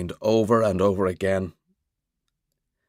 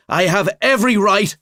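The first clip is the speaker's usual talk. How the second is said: defiant; 12 semitones higher, 7 dB louder than usual. Acted. Finn answering Mick's protest.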